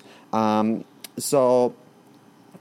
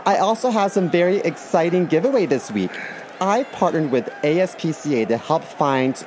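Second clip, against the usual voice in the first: bubbly high influencer vlog voice; chirpy sponsored-video host; 5 semitones higher than usual. Pretend. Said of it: "something like this".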